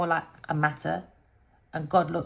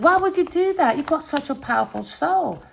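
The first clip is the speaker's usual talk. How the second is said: alarmed pleading; 9 semitones higher, 3 dB louder than usual. Retold or imitated. Retelling what she said to him.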